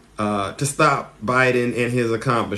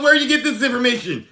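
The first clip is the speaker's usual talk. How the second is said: mock interrogation; 10 semitones higher, faster than usual. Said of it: "He goes".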